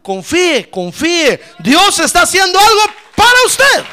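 loud urging building to a high shout; impassioned pulpit shout, peaking high; exhorting shout. Real, not acted. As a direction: drive it up into full-voiced praise. Preacher's exhortation rising to praise.

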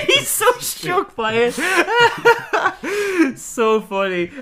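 high, laughing squeal; helpless laughter over the joke; high laugh-filled delight. Real, not acted. Laughing hard at the bit.

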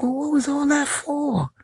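high, slow puzzled voice; mock bafflement. Asking the fleeing drivers, high slow baffled voice. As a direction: ask it high and drawn out, baffled. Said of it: "I'm like".

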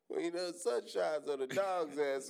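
laughing imitation of dad; mock dad voice. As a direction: play the wise father teasing. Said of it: "My pops would be like".

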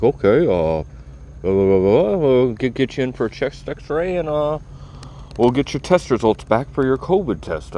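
mock-casual doctor voice; imitating the doctor. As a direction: breeze through it like a bored doctor. Retold doctor rattling off plan.

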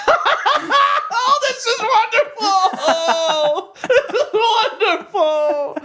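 mock weepy gushing, high and loud, drawn out; hammed-up wailing delight through laughter; overjoyed home buyer. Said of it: "And the guy's like".

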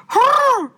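high, loud squeaky animal cry; squirrel call. Retold or imitated. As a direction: screech it like the squirrel. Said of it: "It goes".

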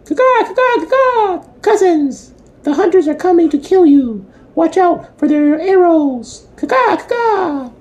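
very high screeching crow voice; shrill screeching bird woman. A narrator's reading. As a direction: squawk it out like a frantic crow. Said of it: "warning the buffalo".

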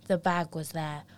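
ordinary delivery, nothing marked

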